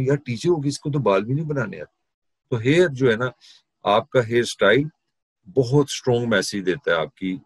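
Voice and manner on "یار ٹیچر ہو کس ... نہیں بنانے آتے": higher, teasing student jibe at the teacher; a student's mock jab, pitched up. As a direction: needle the teacher like a cheeky student. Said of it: "آپ کہیں گے".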